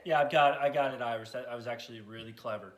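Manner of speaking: a shy low-voiced puppet fumbling through his story; deep puppet voice Matt.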